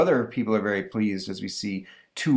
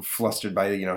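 usual speaking voice

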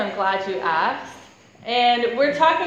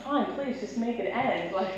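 softer, exasperated mumble with a laugh; weary sister; 9 dB quieter than usual. Retold or imitated. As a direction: give in just to make it stop. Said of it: "I'm like".

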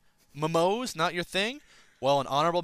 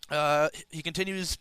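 ordinary talk

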